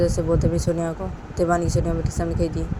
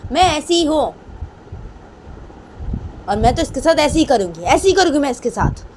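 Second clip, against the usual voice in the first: raised, insistent declaration; defiant Rama; 6 semitones higher, 4 dB louder than usual. Acted. Rama insisting this is how she is, stubborn and heated. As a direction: own your temper stubbornly. Acts Rama owning her temper.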